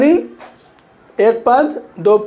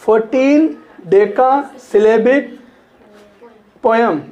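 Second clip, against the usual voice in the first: slow dictation; much slower than usual. Shown as a demonstration. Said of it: "sonnet क्या चीज़ होती है?".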